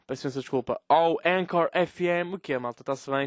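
ordinary delivery

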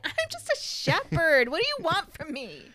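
high, hammed-up protest; mock pleading. Humble shepherd protesting to God, mock pleading.

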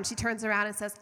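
ordinary talk

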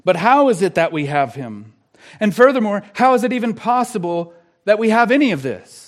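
pressing, emphatic pulpit challenge; urgent preaching. Real, not acted. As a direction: fire the questions at the congregation. Urgent rhetorical questions.

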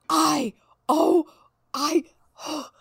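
sputtering gasps building to a loud cry; speechless gasps. Real, not acted. Horrified at hearing the clit called the glowworm, gasping. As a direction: gasp and choke on the words in horror. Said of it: "She's speechless".

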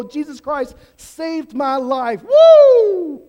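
slow joyful proclamation ending in a loud high whoop; excited praise. Pretend. A joyful worshipper making a joyful noise, ending in a whoop. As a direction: whoop like a fired-up believer. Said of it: "get excited and go".

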